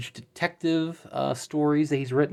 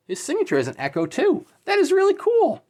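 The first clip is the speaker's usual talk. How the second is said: excited. Real, not acted. Delighted by echoed signature.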